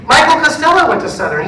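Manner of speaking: high, loud eager news; excited reveal. Retold or imitated. Choir member telling the pastor about the conductor, excited. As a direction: deliver it like a happy surprise.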